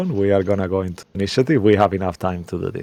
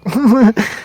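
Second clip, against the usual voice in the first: short high awkward giggle; tipsy awkward giggle; awkward laugh; 11 semitones higher, 3 dB louder than usual. Acted. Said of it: "awkwardly laughed and goes like".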